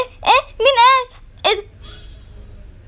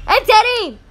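ordinary talk, nothing marked

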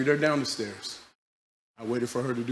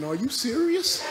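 incredulous thought; 10 semitones higher, 3 dB louder than usual. Retold or imitated. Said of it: "I'm thinking".

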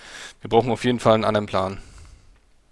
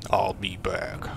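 whispered movie quote; 4 semitones lower, 7 dB quieter, much slower than usual. Pretend. Quoting movie line after dying.

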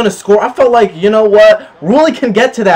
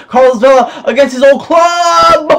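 excited shout; 6 semitones higher, 4 dB louder than usual. Real, not acted. Gamer calling out a chance.

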